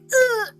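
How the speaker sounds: mock groan. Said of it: "so I'm like".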